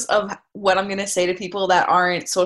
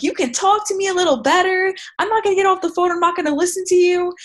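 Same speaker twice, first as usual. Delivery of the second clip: defiant retort; 11 semitones higher, faster than usual. Retold or imitated.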